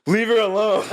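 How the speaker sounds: high, loud retold outburst; hammed-up cry in someone's defence; mock gallant protest. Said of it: "me being the little romantic self".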